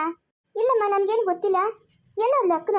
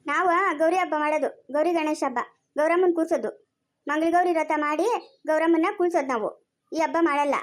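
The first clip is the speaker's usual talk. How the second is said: ordinary talk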